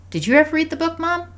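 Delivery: put-on high child's question; curious daughter. A narrator's reading. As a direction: ask Mom curiously in a little-girl voice. Voices curious daughter asking mother.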